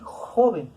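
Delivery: "joven" with JO stretched, then falling; teacher sounding out JO-ven to show the stress. Shown as a demonstration. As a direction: lean on the first syllable of "joven". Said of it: "Joven tiene acento aquí".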